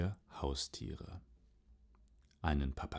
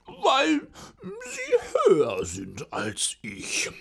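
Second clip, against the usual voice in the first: sehr hoch, laut und langsam gejammert; eitler Pudel; 9 dB louder, slower than usual. Acted. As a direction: angeberischer Pudel, übertrieben klagend. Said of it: "sagte der Pudel". (eitler Pudel, sehr hoch)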